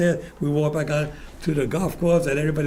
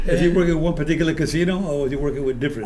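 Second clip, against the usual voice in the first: ordinary talk, nothing marked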